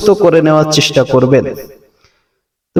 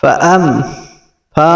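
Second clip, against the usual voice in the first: usual speaking voice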